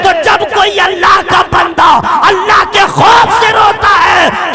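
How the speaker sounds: loud, high shouted sermon building to a cry; fiery preaching. Acted. Preacher about a mother's love, shouting with passion. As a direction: thunder it at the crowd, full passion.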